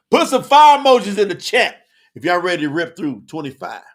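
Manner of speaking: loud, high preacher's exhortation; big call to action; hyped rallying cry. Real, not acted. Preacher rallying the live chat.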